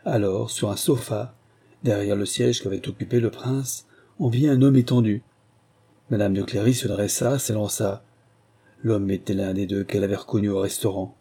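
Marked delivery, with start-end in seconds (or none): none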